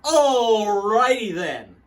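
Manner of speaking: over-the-top ham; loud comic voice. Retold or imitated.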